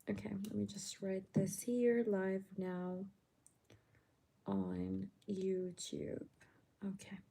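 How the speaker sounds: soft whisper so nobody wakes; hushed late-night whisper. Real, not acted. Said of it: "I can't make my voice any louder because it's midnight".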